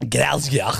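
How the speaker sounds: mangled Scottish "Glasgow"; mocking Scottish. Co-host mocking the attempt, mangled Scottish accent. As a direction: parrot the botched Scottish vowels.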